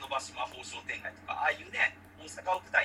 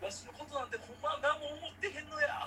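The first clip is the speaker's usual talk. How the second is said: put-on higher-pitched, whiny Kansai-dialect old man; Osaka dialect old man; 12 semitones higher than usual. Osaka-dialect dad chased by a lizard, whining in Kansai dialect. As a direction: play the pitiful Osaka-dialect dad begging for help. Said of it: "大阪弁のね、変な親父が".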